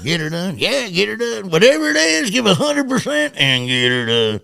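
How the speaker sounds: high-pitched, playful takes on a catchphrase; squeaky-high demo of different deliveries; catchphrase variations. Shown as a demonstration. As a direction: play around with the catchphrase way up high. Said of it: "I'd say it all different ways".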